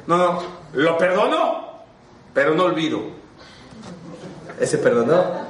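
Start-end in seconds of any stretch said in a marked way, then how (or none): 0.1-3.0 mock grudging forgiver. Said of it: "Como los que dicen".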